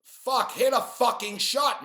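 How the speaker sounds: loud, high frustrated shout at the TV; frustrated yell. Real, not acted. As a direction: yell at the players to just make a shot. Yelling at players on TV.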